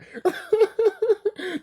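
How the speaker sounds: falling high laugh. Real, not acted. Laughing at the Pop-Tart dog, high laugh.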